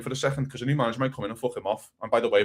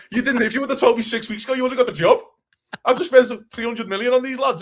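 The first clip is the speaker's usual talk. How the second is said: raised, higher voice scolding the new manager; mock rant at manager; 7 semitones higher, 5 dB louder than usual. Football fan ranting at a new manager, mock indignant and loud.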